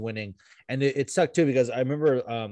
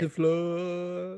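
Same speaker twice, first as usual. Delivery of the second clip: very slow drawn out; 6 semitones higher, 4 dB quieter, much slower than usual. Acted. Title joke, drawn out.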